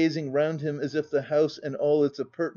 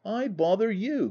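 natural surprise; 6 semitones higher than usual. A narrator's reading. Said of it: "cried she, in very natural surprise".

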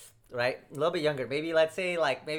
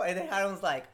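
normal talking voice

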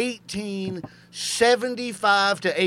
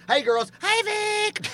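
high-pitched hello to friends; mock friendly greeting; much slower than usual. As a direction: trade exaggerated hellos across a room.

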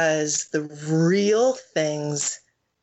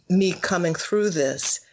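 normal talking voice

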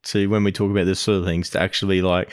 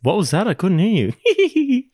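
teasing laugh; 11 semitones higher than usual. Acted. Teasing wife about her lost voice.

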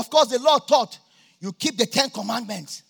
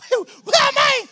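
squeaky high dazed cry, much higher and louder; dazed surprise; 8 dB louder, slower than usual. Retold or imitated. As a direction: play the stunned victim who just got spun by a slap. Slapped man spun around, dazed.

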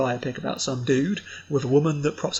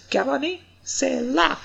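put-on French kid; 9 semitones higher, 3 dB louder, slower than usual. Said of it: "this kid just goes".

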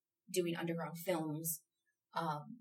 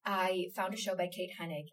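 ordinary talk

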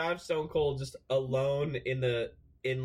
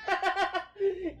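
high laugh. Real, not acted. Laughing at Oreo.

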